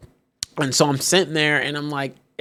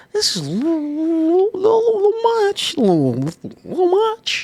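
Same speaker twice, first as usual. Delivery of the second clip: awkward objection; 11 semitones higher than usual. Retold or imitated. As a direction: stretch the objection out in a tiny high voice. Acts hesitant objection to passenger.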